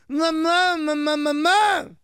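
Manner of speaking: put-on kid calling mamá, slowly. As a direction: call out for mom like a little kid in a joke. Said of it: "con la mamá y le dice".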